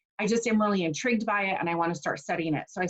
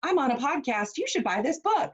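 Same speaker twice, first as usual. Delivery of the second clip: higher, singsong mock podcaster; podcast host; 6 semitones higher than usual. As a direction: imitate a podcaster plugging something in a high lilt. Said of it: "by somebody who's talking".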